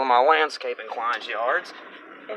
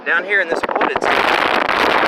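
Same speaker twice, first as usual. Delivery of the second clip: raised voice against the wind; shouting over wind; 4 semitones higher, 5 dB louder than usual. Real, not acted. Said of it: "if you can even hear me over the wind".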